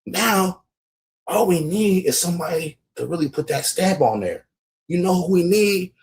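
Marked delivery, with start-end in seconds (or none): none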